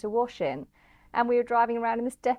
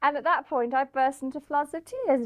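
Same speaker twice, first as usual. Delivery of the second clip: ordinary talk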